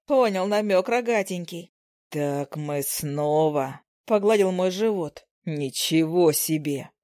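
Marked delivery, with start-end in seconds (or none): 2.1-3.8 slow surprised husband. Acted. Husband realizes wife is pregnant. 5.4-6.9 amazed husband. Acted. Husband amazed by pregnancy news.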